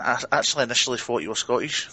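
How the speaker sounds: ordinary delivery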